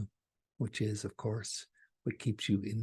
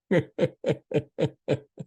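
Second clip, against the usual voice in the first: bright burst of laughter, loud; high laugh; 7 semitones higher, 9 dB louder than usual. Real, not acted. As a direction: laugh at the complainer you just played. Laughs after mock complaint.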